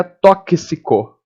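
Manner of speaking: "tóxico" slowly as to-ki-si-co; slow broken-up "tóxico"; split k-s sound. Shown as a demonstration. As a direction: separate every sound in "tóxico".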